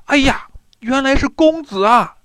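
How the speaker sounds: high, loud astonished exclamation; surprised cry. A narrator's reading. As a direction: cry out in shocked recognition. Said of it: "一起惊讶的大叫".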